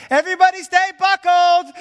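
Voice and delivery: very high, loud commanding call from the back; flight attendant shout. Retold or imitated. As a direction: bark the order over the turbulence. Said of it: "she's like".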